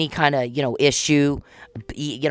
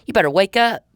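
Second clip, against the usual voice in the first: raised, higher warning shout; sharp warning; 6 semitones higher, 4 dB louder than usual. Real, not acted. Warning Mister Powell to wake up, raised and sharp. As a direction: jolt him awake with it.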